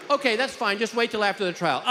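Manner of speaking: high-voiced judge, unbothered; judge's breezy reply. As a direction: answer lightly and high from the bench. Judge replies, acted.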